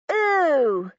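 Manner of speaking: loud high mocking ooh; taunting yell at the new girl. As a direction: jeer it out at him. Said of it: "he yells".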